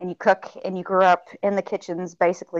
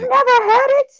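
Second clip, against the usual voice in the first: high, loud gasp of a question; can't believe they never had it; jaw-drop squeal of surprise; shocked disbelief; 8 dB louder than usual. Real, not acted. Gumbo never tried, shocked.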